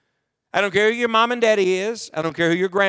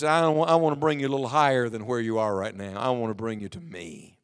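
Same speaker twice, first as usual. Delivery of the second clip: soft, low voice of God fading almost to nothing; God's voice, hushed; 10 semitones lower, 6 dB quieter than usual. Retold or imitated. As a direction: let God's call drop to a gentle hush. God speaks at night.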